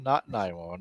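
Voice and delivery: "not nine one one" dropped low, as the alternative; torn deliberation. Retold or imitated. Weighing the opposite option.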